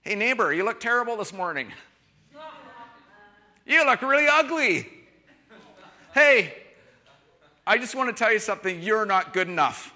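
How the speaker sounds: a neighbor greeting someone with cheerful insults, mock rude; mock insulting neighbor. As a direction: taunt the neighbor cheerfully to his face. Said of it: "or that would be a mess".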